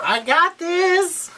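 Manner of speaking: high, very drawn-out mock confidence; mock cocky. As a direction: stretch it out in a showy high voice. Retold Selena, showy confidence.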